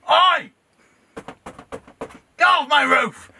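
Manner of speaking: loud high angry yell; shouting. Real, not acted. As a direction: shout them off the roof. Shouting at pigeons.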